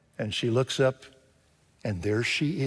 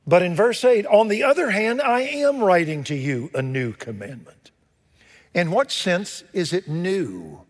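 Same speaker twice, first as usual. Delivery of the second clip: booming sermon delivery; full-force preaching; 5 semitones higher than usual. Acted. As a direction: proclaim it to the whole congregation.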